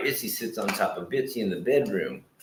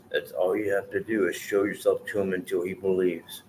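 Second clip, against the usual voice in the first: heartfelt prayer. Acted. Praying aloud for his friend Aaron, earnest prayer. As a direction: pray sincerely, letting each phrase land.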